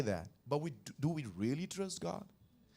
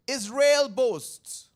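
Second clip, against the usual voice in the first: high, loud and slow; weighty reading; 7 semitones higher, 10 dB louder, much slower than usual. Acted. Preacher reading the verse to the church, slow and loud. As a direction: draw out Israel boasts.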